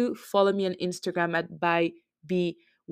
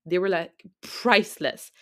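usual speaking voice

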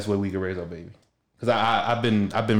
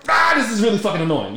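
louder, higher exasperated snap; fed up and snapping at the hassle; annoyed outburst; 10 semitones higher, 5 dB louder than usual. Retold or imitated. With an aching tooth, telling how he snapped in annoyance. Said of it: "And I just snapped".

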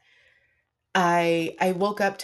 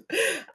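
short laugh; 14 semitones higher, 3 dB quieter than usual. Real, not acted. Laugh after swearing.

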